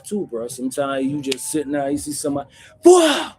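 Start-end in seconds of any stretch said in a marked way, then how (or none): 2.8-3.3 startled whoa. Retold or imitated. Acting out a sudden slap.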